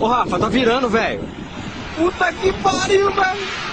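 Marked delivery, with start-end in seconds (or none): 2.0-3.7 awed. Real, not acted. Amazed at view while filming.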